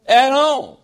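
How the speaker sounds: sudden high, loud punchline; exclaimed joke. Real, not acted. Preacher to congregation, where he'd rather be, loud high exclaim. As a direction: burst out with the answer.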